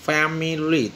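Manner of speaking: Family split as fa, mi, lee; syllable by syllable. Shown as a demonstration. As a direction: give family three separate syllables. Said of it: "तीन इसके होंगे". (Family کو تین حصوں میں توڑ کر بولیں)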